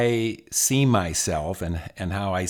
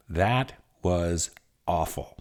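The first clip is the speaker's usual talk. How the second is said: slow, drawn-out verdict, word by word; wife's blunt verdict; 2 semitones lower, 3 dB quieter, slower than usual. Retold or imitated. Wife to husband after his panel, blunt verdict. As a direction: spell out the disapproval slowly. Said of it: "And she goes".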